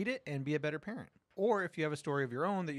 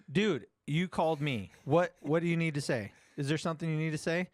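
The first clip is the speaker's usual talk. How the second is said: louder, half-shouting at the phone; loud impatient demand; 4 dB louder than usual. Retold or imitated. Dad demanding his son speak up, loud and exasperated. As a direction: push hard, loud and exasperated. Dad pressing a silent caller.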